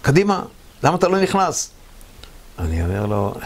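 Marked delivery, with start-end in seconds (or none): 0.0-1.7 high, raised urging call. Retold or imitated. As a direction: hurry him in with a raised voice. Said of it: "הוא רק אומר לי".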